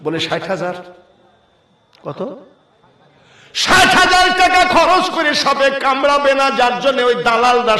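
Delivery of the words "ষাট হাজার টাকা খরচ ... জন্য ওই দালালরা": high, loud cry easing down to normal pitch; indignant preaching. Real, not acted. Preacher decrying the snakebite money to the crowd, high indignant exclamation. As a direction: burst out high, then descend steadily.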